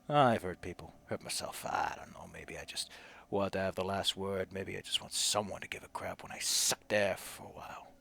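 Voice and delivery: low, hushed confession; regretful dying Sam. Acted. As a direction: sink from spoken words into a near-whisper. Voices Sam's confession.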